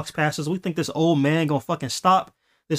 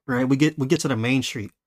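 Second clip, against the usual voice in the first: usual speaking voice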